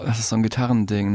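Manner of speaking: ordinary talk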